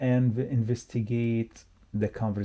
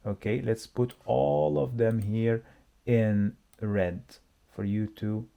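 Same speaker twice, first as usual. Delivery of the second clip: ordinary delivery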